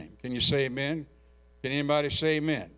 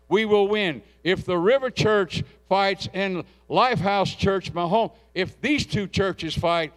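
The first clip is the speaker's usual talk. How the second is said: loud, high, rousing preacher's call; full-force preaching; 5 semitones higher, 5 dB louder than usual. Acted. Pastor rallying his congregation to fight and win, preaching loud. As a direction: fire the church up for battle.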